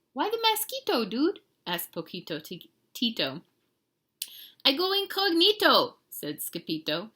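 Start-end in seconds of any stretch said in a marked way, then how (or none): 0.1-1.4 very high small-dog voice; retry the little pup's question, pitched high; squeaky cartoon questioner; tiny Chihuahua. A narrator's reading. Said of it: "the smallest of the small ones spoke up". 4.6-6.0 high, raised cartoon voice; high-pitched storybook reply; cagey little hero. A narrator's reading. Hero answers in character.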